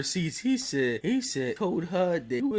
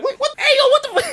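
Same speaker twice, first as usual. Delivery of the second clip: very high, rising alarmed squawk; mock startled outburst; 6 dB louder, faster than usual. Uncle as nephew suddenly runs off, mock startled yelling. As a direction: yelp it, playing shocked and indignant. Retold Bernie startled as Jordan bolts.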